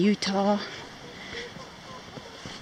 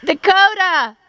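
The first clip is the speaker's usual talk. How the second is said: calling out; 7 semitones higher, 8 dB louder than usual. Real, not acted. Calling dog across canyon.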